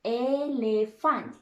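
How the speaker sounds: teacher repeat of Elephant; model word. Shown as a demonstration.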